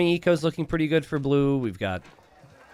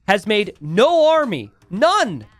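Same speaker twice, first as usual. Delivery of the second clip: higher, louder live commentary, urging the players on; excited play-by-play; 4 semitones higher, 4 dB louder than usual. Acted. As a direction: call the game as it unfolds, pleading with Red.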